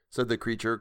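usual speaking voice

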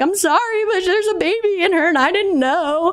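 high, flustered apology through laughter. Retold or imitated. To the yoga class, a high flustered apology. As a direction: blurt it out high and giddy. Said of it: "I was like".

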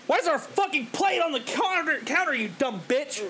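high, mocking yell at the girlfriend; imitating the guy berating his girlfriend; mock angry boyfriend. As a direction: sneer it like a petty tyrant. Mocking the guy yelling at wife.

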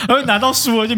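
words through a high laugh. Real, not acted. Host laughing about getting a free book. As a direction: keep laughing as you say it.

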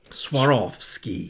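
Swarovski drawn out as swar-OFF-ski; slow careful pronunciation. Shown as a demonstration. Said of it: "Pretty straightforward once you know".

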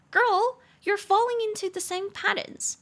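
put-on high, sassy "Girl" to a friend; scolding a girlfriend. Friend scolding her girlfriend about toxic dating, sassy and high. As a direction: call her out, big sister style. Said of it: "我就会也会跟她讲这句话".

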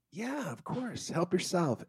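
high, soft voice; obliging friend. Acted. Storyteller as Hisham offering crackers, high soft obliging voice. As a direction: lift it high and light, softly.

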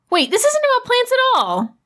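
high startled outburst; sudden realization. Acted. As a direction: suddenly get it, pitched high.

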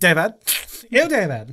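put-on high, disgusted valley girl whine; mock valley girl sneer. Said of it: "like, Valley, Valley Girl".

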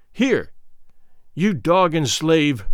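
ordinary delivery, nothing marked

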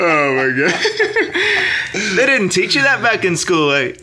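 high laughing reply. Real, not acted. Host cracking up at his guest's answer. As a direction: laugh through the words. Reacting to the joke.

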